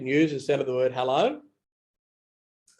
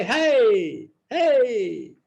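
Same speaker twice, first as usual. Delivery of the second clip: higher, breezy "Hey, hey" between passers-by; casual street hey; 9 semitones higher, 3 dB louder than usual. Shown as a demonstration. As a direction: toss off a quick high hey to a passerby. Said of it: "they say".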